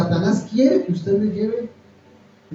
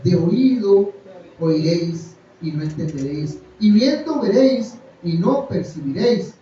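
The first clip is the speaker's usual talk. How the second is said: scripture reading; 2 semitones higher, 4 dB louder than usual. Acted. Reads scripture with force.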